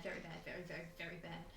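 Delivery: voiced stage whisper, quick. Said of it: "Stage whispering for the mic".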